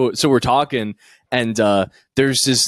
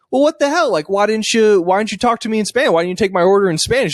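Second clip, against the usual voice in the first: indignant retort; 9 semitones higher than usual. Retold or imitated. Angry guy confronts drunk man.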